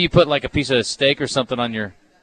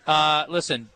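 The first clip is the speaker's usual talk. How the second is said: ordinary delivery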